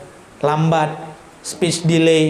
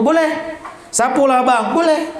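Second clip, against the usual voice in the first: put-on cheeky teen; 7 semitones higher, slower than usual. Defiant kid talks back.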